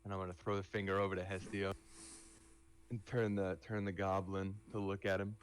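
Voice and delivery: usual speaking voice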